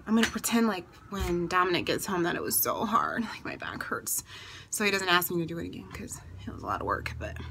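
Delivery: hushed whisper, barely voiced. Real, not acted. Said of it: "why am I whispering".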